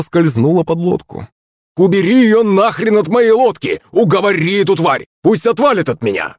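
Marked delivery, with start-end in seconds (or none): 1.8-6.4 high-pitched agitated yelling; frantic shouting. A narrator's reading. Захарыч ordering Акулыч to call off the shark, shouting. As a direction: demand it in a high, strained cry. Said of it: "кричал Захарыч".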